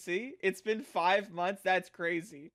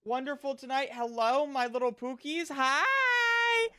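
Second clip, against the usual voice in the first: cute anime streamer; 7 semitones higher than usual. Acted. Streamer greeting chat in cute voice.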